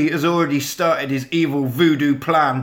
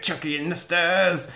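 usual speaking voice

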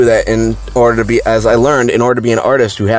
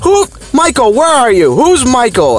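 much higher, shrill mock scream of an outraged teacher. Substitute teacher screaming at the muddy painting, much higher. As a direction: shriek in disbelief at the muddy painting. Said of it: "all you hear is her screaming".

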